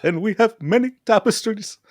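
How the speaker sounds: high-pitched mock Scottish lord; deliberately over-the-top fake Scottish brogue; bad Scottish accent. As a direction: put on a hammy Scottish accent, high and indignant. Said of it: "I can do all those accents".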